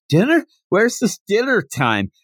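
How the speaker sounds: high, eager confused questioning; mock concern. Goku looking for his dinner, mock-concerned. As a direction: imitate a just-woken hungry fighter. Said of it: "like, concerned now".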